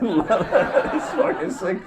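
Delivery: ordinary talk, nothing marked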